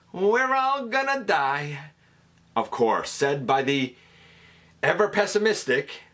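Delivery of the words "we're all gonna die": high, squeaky panicked doom line; Rat Trap squeak. Retold or imitated. Fan quoting Rat Trap's pessimistic catchphrase, squeaky. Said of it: "said with that particular accent ... squeak".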